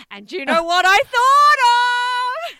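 high, loud, drawn-out exclamation; really excited, stretching the words loudly; excited outburst. Real, not acted. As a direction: burst out with it, pitch soaring. Excited reveal of a shared memory.

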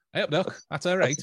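high, loud Stoke dialect greeting; put-on Potteries accent. Shown as a demonstration. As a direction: call out cheerily in broad Stoke dialect.

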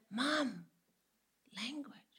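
soft, prim mock telling-off; hushed reproof. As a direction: chide mom under your breath. Imitates son quietly reproving mother.

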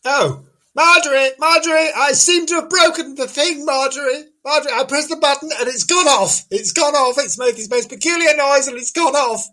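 high-pitched comic panic; hammed-up helpless fluster; flustered calling. Calls helper about broken machine.

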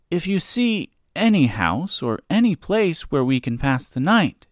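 a hint of a little girl asking; young girl voice. Dorothy voiced by narrator.

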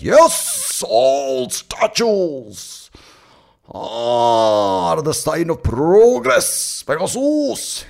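high, drawn-out mock orator; total conviction. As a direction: declaim it slowly with overblown conviction. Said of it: "say it completely committed to the idea".

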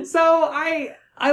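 high burst of laughter; laughing. Real, not acted. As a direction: laugh through the words. Laughing at the joke.

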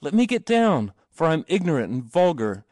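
high-pitched urgent protest; cried out. A narrator's reading. Boy lifted on the shield, cried out. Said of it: "he cried".

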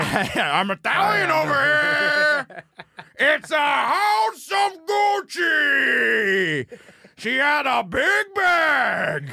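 high, very slow, shouted bad Italian accent; mocking Pacino's bad Italian accent. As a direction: bellow a wobbling Italian accent. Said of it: "could not do an accent".

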